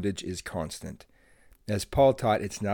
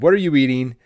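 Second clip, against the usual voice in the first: stern mom; 3 semitones higher, 9 dB louder, faster than usual. Retold or imitated.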